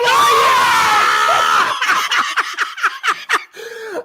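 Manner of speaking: shrieking, crying laugh; screaming laugh. Real, not acted. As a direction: howl with joy. Draft pick, wild celebration.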